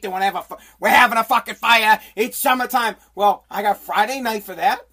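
ordinary talk, nothing marked

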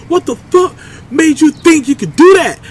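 high, incredulous mock scolding; imitating yelling doctor. Doctor scolding the injured climber, mock incredulous yell. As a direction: demand it in high-pitched outrage. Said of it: "he said, just like that".

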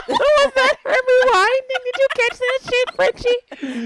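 high, slow, laughing disbelief. Real, not acted. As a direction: ask it again through laughter.

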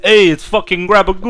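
high-pitched Sopranos-style Italian-American; hammed-up Italian-American wiseguy accent. Hosts riff on a meatball ghoul character, hammed-up Italian-American accent. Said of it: "American Italian where he talks like it".

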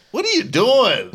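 high-pitched mock scolding; retold exasperated telling-off. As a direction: demand it like a cross parent. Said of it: "you can scold him".

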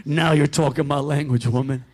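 put-on a smug country husband in a Southern accent, pitched low. Husband answers his wife in a low Southern accent. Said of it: "I'm gonna do an accent".

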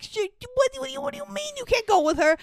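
flustered Sam. Retold or imitated. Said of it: "He's like".

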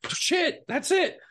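high-pitched, delighted discovery; retold excited approval. Director hearing the right accent, excited. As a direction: react as if it is suddenly perfect.

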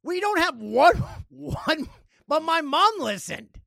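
high, loud, slow comic outrage; mock protest. Host protests that even mom listened, mock outrage. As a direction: protest the stat in playful disbelief. Protesting the all-male stat.

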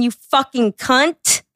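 usual speaking voice

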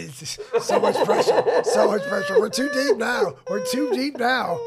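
high, loud mock panic through laughter. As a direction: laugh while playing up the panic. Mock panic amid host's confession, laughing.

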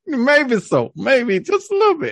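high laughing agreement. Real, not acted. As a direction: laugh through it.

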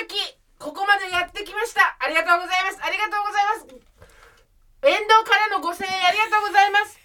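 high, pleading campaign candidate call; election car loudspeaker. Shown as a demonstration. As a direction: beg for votes from a campaign van. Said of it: "私が今やりたいのはドブ板風。".

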